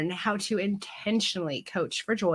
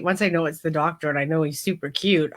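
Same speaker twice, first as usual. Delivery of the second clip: ordinary talk, nothing marked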